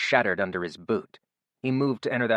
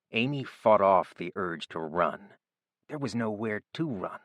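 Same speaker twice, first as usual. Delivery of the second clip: ordinary delivery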